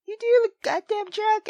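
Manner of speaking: ordinary delivery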